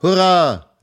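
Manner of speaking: put-on high, loud children's hurrah; joyful loud cheer; cheering shout. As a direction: shout it out like a crowd of kids. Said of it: "riefen die Kinder".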